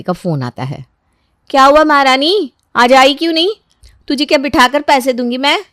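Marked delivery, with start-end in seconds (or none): 1.5-5.6 high, loud, sarcastic rebuke; indignant raised-voice questioning; scolding employer. Acted. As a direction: tell off the maid sharply. Employer scolding absent maid by phone.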